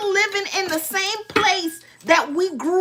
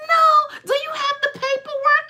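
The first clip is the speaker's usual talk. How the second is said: high, prim mock mother; mother's demand; 8 semitones higher than usual. Mother feigning innocence asks daughter for paperwork, high mock voice. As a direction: ask for paperwork like an innocent old lady. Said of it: "She like".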